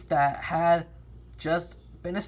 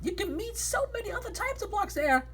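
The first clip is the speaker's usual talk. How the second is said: high character voice; 4 dB quieter than usual. Acted. Character invites in story.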